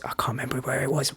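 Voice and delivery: fast breathy whisper under the breath; hushed, hurried aside. Real, not acted. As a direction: drop to a quick whisper, as if to yourself. Quick whispered aside mid-story.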